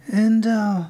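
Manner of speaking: a lightly curious, probing question; prying curiously. A narrator's reading. Irene pries about Nora, mildly curious. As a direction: fish for the answer, casually nosy. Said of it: "she pried curiously".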